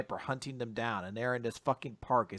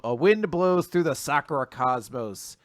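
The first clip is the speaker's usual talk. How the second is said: usual speaking voice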